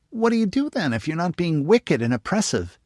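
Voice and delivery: put-on high, eager little girl; young girl voice. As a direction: pitch it up like a curious young girl. Narrator voices young girl Jane.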